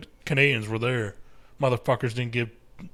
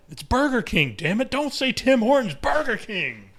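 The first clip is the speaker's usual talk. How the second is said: mock insistent reply; 3 semitones higher than usual. Mock exchange about Burger King.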